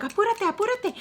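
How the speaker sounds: high-pitched impatient hurrying; snapping. Retold or imitated. Host telling the guest to hurry up, snapping. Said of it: "Like, snapping".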